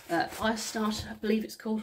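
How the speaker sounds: ordinary talk, nothing marked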